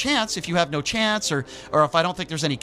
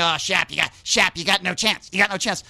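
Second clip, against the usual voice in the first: taunting friend. Retold or imitated. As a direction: needle him in front of her.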